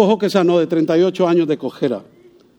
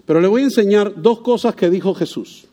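ordinary delivery

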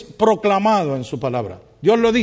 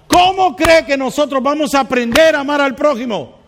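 high, loud fervent sermon; preaching at full force; 9 semitones higher, 6 dB louder than usual. Acted. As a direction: rouse the congregation, preaching hard. Preaching climax.

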